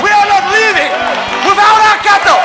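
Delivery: loud, high fiery declaration; preaching at full force. Acted. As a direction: shout it like a rallying cry.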